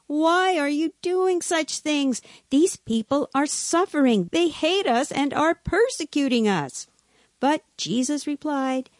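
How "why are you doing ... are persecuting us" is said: high-pitched, pleading reproach; admonishing. A narrator's reading. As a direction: scold the child with urgent concern. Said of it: "admonished him privately".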